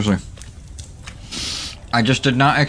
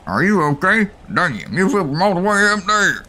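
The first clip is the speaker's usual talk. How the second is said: put-on southern accent; 7 semitones higher than usual. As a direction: play it as a Southern American local. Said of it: "suddenly turned southern".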